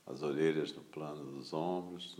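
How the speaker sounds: ordinary delivery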